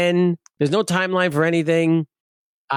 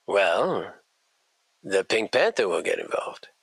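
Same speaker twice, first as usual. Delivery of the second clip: lower, softer menacing collector voice; low mock Chewy threat; 7 semitones lower, 6 dB quieter than usual. Collector Chewy threatening Eric with the Pink Panther, low mock menace. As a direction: growl the threat low and soft.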